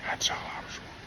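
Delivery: breathy whisper echo; whispered repeat. Real, not acted. Pastor whispering his lament a second time. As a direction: whisper it to yourself.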